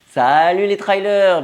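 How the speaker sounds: high, loud, stretched-out hello; slow booming welcome to the trail runners; big channel greeting. Acted. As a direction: belt out the greeting to the fans. Channel greeting shouted to viewers.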